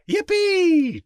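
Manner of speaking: high, gleeful cowboy whoop; wild yell. A narrator's reading. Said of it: "The wild yells of the riders".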